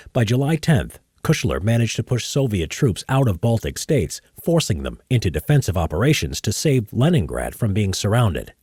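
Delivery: measured history narrator read; documentary narration. Acted. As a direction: read it like a war documentary voice-over.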